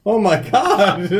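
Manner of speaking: high, drawn out through laughter; amused disbelief. Real, not acted. Friend hearing of kicked tire, laughing disbelief. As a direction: laugh through the words, slowly. Listener cracking up at the story.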